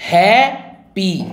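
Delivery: Happy slowly in two separate beats; happy split with a clear gap between syllables. Shown as a demonstration. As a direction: sound out happy as HAP, then PEE. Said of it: "दो syllable".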